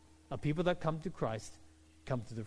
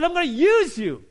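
much higher and louder, proclaimed; booming declaration; 11 semitones higher, 11 dB louder than usual. Acted. Preacher proclaiming God's promise to Peter, loud and high. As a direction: announce it like a trumpet.

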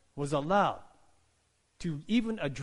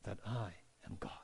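soft, lower preacher's voice; hushed call to stillness; 4 semitones lower, 8 dB quieter than usual. Acted. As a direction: speak softly, letting each phrase settle. Voicing God calling for stillness.

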